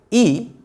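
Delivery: ई held high and clear; vowel i demo. Shown as a demonstration. Teacher contrasting vowels, sounding ई. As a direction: sing out ई as a separate sound. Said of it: "अलग सुनाई भी दे रही है".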